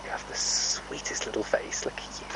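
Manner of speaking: soft whispered sweet talk to the horse; whispered coo. Real, not acted. Horse owner whispering praise to her pony, tender coo. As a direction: hush and coo over the little face.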